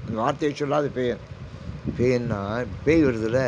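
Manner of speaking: ordinary delivery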